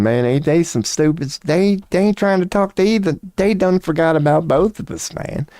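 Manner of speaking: lightly voiced bored Crowley.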